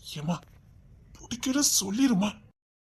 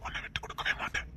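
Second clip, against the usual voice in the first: whisper; 15 dB quieter than usual. Acted. Tender promise.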